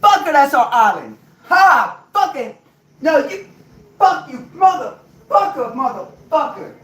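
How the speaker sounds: high, loud screaming tirade; shrieking high-pitched cursing rant; furious wife yelling. Retold or imitated. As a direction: scream it at him like a betrayed wife. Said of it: "one said".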